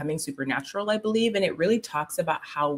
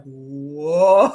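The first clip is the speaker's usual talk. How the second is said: amazed whoa; 3 semitones lower, 6 dB louder than usual. Retold or imitated. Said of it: "I was just like".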